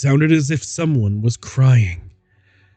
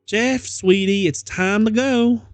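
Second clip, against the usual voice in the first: mother's voice; 8 semitones higher than usual. Acted.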